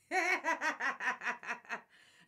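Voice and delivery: a big, loud, high laugh breaking out; loud burst of laughter. Real, not acted. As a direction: laugh hard at your own joke. Laughs at own joke about grandma.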